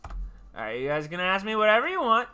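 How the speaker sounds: usual speaking voice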